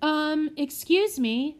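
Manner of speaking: put-on polite boy straining to be heard; trying to be heard. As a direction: raise the voice over the crying, slowly. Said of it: "trying to make himself heard".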